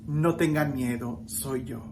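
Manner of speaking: low, softened retold comfort; quiet reassurance. Preacher voicing Jesus to scared disciples, low and calm. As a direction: drop the voice to calm them, fading away.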